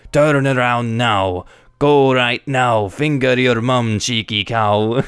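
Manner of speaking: slow, hammed-up thick Welsh accent. A narrator's reading. Older guy telling off entitled mother, thick Welsh accent. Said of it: "In the thickest Welsh accent I can imagine".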